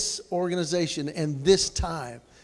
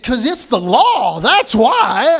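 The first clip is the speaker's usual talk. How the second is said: mock authority; 3 semitones higher, 8 dB louder, slower than usual. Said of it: "I'm like".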